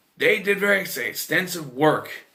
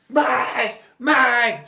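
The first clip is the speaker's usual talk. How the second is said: imitating a screechy, squealy yell; high, loud mock shriek; Heather; 12 semitones higher, 7 dB louder than usual. Imitating her constantly yelling in the film, squealy yell. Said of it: "yelling and a squeech-, screechy, squealy voice".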